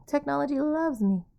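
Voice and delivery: sarcastic. Acted. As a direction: repeat it with an eye-roll in the voice. Pen and screen keep failing.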